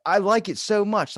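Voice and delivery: loud, high mock Tyson, proud; Mike Tyson voice. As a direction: pitch up and boast like a cartoon boxer. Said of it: "Mike Tyson goes".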